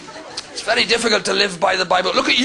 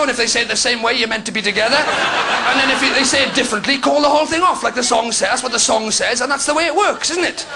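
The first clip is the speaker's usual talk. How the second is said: ordinary delivery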